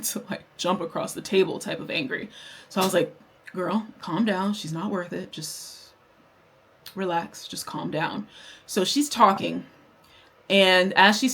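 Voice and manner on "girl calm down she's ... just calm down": calming self-talk. Retold or imitated. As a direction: talk yourself down quietly. Retold talking herself down.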